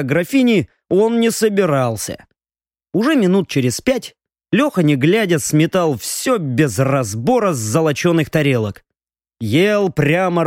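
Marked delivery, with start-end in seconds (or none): none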